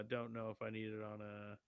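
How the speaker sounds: normal talking voice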